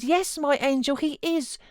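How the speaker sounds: high, joyful, relieved reassurance; cried out. Acted. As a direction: comfort her with tearful joy. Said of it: "I cried".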